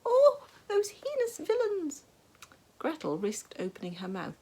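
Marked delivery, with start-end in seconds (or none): 0.0-2.0 very high, loud, slow theatrical shriek. A narrator's reading. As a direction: shriek at the prisoners in fake horror. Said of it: "and shrieked".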